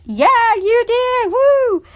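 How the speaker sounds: high, loud whoop of celebration; excited cheering. Retold or imitated. Stepmom cheers stepson's first wrestling win, elated. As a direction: cheer on a winning kid.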